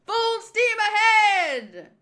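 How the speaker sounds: high, loud, drawn-out command; rousing shipboard shout. Acted. Crew answering the captain's order, high drawn-out shout. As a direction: bellow the order across the deck.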